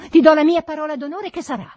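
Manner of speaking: high, fast, loud insistence; shout. A narrator's reading. Master swears to his servant, shouting. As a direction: shout the oath in a rush. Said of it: "gridai io".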